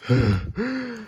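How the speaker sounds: high startled gasp of realization; sudden gasp. Acted. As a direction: catch the breath in sudden insight. Bob realizes something.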